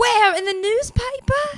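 high, drawn-out incredulous question; slow, squeaky comic incredulity; mocking disbelief. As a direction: scoff with the pitch sky-high. Judge mocks Tom's stats claim.

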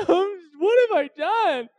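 high, slow hammed-up wail; mock crying donkey. Donkey sobbing to its master, hammed-up wail. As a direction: whimper it like a hurt animal. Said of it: "The donkey's crying".

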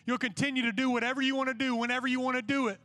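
forceful, emphatic sermon challenge; insistent preacher confronting the crowd; impassioned preaching. Real, not acted. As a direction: press the challenge home, punching the key words. Exhorts the students about commitment.

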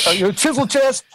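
put-on Massachusetts accent. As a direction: play the fired-up Boston tech, badly mimicked. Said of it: "I can't even recreate it".

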